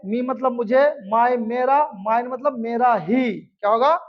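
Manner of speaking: normal talking voice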